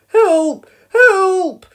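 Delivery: two high, very loud yells; crying for help. A narrator's reading. Boy trapped at the bedroom window, crying for help. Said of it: "cried Norman".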